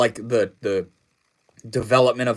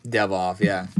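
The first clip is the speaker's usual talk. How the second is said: talking with mouth full; words around a mouthful; 5 semitones lower than usual. Real, not acted. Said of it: "Sorry, I had something in my mouth there".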